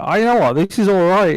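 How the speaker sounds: lifted mock approval for an arcade game; pleasantly surprised. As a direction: act won over despite yourself. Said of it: "Some of them are like".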